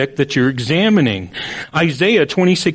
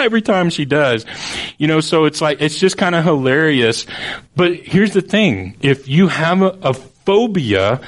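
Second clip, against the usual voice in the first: ordinary talk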